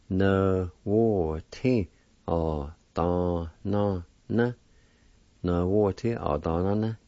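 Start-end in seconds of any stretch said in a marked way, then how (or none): 0.1-4.5 na wo ti ah da na na said as a chain of syllables; syllables in sequence. Shown as a demonstration. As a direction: run the seven syllables in a row. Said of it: "And together".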